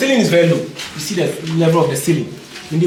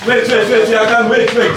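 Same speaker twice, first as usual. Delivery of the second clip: calling out to wait; 5 semitones higher, 7 dB louder than usual. Real, not acted. Guide calls group to stop.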